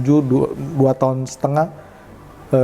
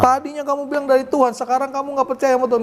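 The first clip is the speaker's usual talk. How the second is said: high-pitched indignant scolding, acted out; retold anger; 9 semitones higher than usual. Husband scolds wife for losing faith, re-enacted angry. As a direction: re-enact telling her off, pitch held up throughout. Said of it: "saya marah".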